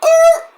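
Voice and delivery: high, loud screech; sudden high-pitched "errr"; imitated brake screech.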